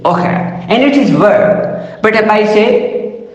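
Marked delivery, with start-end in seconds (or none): none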